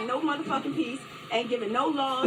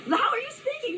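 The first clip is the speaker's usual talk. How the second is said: mocking squeak, much higher; squeaky mouse voice; 11 semitones higher than usual. Woman mocks officer's voice with a much higher squeaky mouse voice. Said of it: "you got the squeaky mouse voice".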